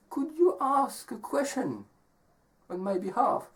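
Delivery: high, soft storybook woman; young woman asking. Acted. Young woman asking the traveler a favor, high and soft. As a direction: make a gentle high-pitched request. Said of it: "And she says".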